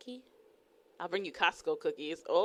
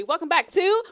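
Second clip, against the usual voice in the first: show title call; 9 semitones higher, 10 dB louder than usual. Acted.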